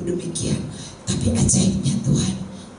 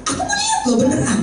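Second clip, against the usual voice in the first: squealing high with laughter, quick; laughing tease; faster than usual. Real, not acted. Preacher teasing young women to take masks off, laughing high. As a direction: giggle it out in a high, fast rush.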